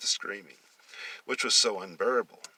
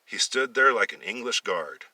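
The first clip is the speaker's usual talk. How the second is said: plain reading voice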